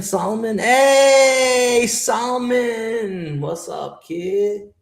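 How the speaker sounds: high, drawn-out call of Solomon. Real, not acted. Streamer greeting viewer Solomon, calling out loudly. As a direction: hail him like a friend across the room.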